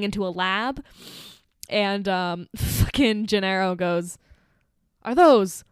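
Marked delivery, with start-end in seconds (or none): 5.0-5.6 louder, rising at the end; mock loud question. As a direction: blurt it out loudly, mangling the word. Quoting film character, loud mock bewilderment.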